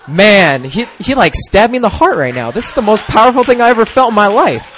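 loud, high overwhelmed outburst; retold amazement. Telling the group how the prayer hit him, amazed. Retelling his overwhelmed reaction.

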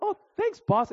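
very high, squeaky-sweet reply; mock flattered. Thanking the shop owner for a compliment, mock flattered.

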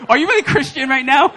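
high, loud incredulous challenge; hammed-up outraged question; mock shocked listener. Congregation doubting the preacher's faith, mock shocked. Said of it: "you guys are like".

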